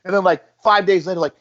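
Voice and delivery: ordinary delivery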